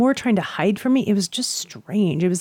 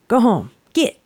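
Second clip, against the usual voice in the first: higher-pitched mock yell at a dog; retold shooing call, pitched up; imitating a yell; 4 semitones higher than usual. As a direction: shoo a dog home with a half-hearted yell. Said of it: "start clapping and yelling".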